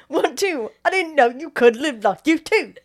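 loud mock British accent; hammed-up British patter. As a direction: put on a rowdy British accent. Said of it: "every time you ask somebody to do a British accent".

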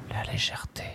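la légèreté breathed as a soft whisper; la légèreté faded to a whisper; whispered echo. Shown as a demonstration. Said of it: "echo repetition".

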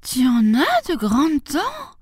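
put-on high, slow child's exclamation; high-pitched, stretched-out alarm; alarmed little girl. A narrator's reading. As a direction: draw out the shock in a high girl's voice. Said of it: "s'écria le petit Chaperon Rouge".

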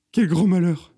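high, loud cry of woe; dramatic lament. A narrator's reading. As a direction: bemoan it theatrically. Lamenting the courgettes' fate.

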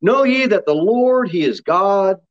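slow, drawn-out psalm reading; scripture reading. Real, not acted. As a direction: read it out to the congregation, stretching the key words. Reads the psalm aloud.

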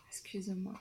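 soft whispered apology; whispered aside. Real, not acted. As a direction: breathe it, barely voiced. Aside while searching notes. (excuse chuchotée)

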